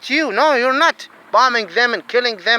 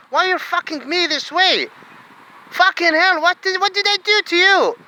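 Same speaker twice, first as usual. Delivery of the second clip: desperate outcry; 9 semitones higher than usual. Real, not acted. Man crying out at those ruining him, high and anguished. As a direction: cry out in despair. Desperate outburst about loan.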